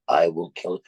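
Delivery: rough man's voice. Retold or imitated. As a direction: drop low for the threat. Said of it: "a rough man's voice you hear".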